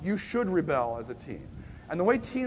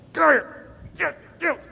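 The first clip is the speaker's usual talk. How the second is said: a hint of a bossy kid, higher and louder; mock a small boy ordering his brother; bossy little boy; 9 semitones higher, 6 dB louder than usual. Little boy in church calling brother over, bossy. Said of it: "we'd hear his voice going".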